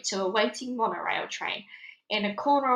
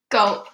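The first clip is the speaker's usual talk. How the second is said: loud gulp; 8 dB louder than usual. A narrator's reading. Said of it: "heard Space Boy gulp".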